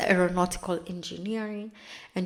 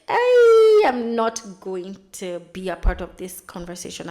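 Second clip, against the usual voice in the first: mock panicked refusal; 11 dB louder than usual. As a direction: blurt it out, stretching the opening vowel. Voicing Penelope backing away from chat.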